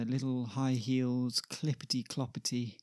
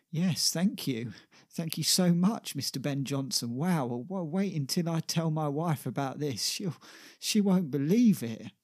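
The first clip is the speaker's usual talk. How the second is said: higher, louder starstruck gushing; thrilled fan; 4 semitones higher, 5 dB louder than usual. Acted. Shipyard man thanks Ben Jonson for signing his arm, gushing. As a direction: gush like an acted starstruck fan.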